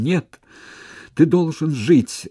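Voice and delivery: high, loud despairing cry; desperate cry. Acted. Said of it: "выкрикнула она с отчаянием".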